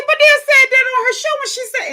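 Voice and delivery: put-on high, innocent toddler voice; little girl voice. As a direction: sound like a small child protesting. Voicing toddler.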